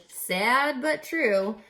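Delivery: ordinary delivery, nothing marked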